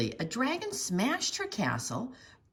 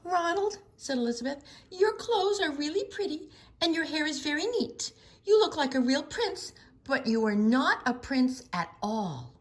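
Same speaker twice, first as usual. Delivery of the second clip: sarcastic sweetness; 8 semitones higher than usual. A narrator's reading. Elizabeth mock-sweet reply.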